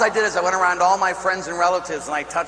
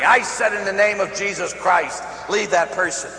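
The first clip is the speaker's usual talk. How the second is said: louder, higher, brisk exorcist order; insistent repeated command; 5 semitones higher, 3 dB louder than usual. Retold or imitated. Dreamer reordering a laughing devil out, loud and insistent. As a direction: hammer it out for a devil who wasn't listening. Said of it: "I don't think you heard me".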